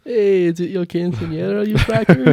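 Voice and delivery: rising to a loud, high, hammy mock Mexican accent. Teasing his friend in a loud, high mock Mexican accent. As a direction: build to a squeaky shout in a bad Mexican accent. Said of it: "I never want that voice to return".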